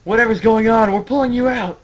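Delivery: loud, high anime yell; hammed-up urgent rescue shout; rescuers shouting. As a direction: holler over to a friend. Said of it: "other guys kinda collectively are saying".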